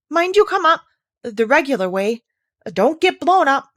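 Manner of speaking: loud, high call of warning; shouted warning. Acted.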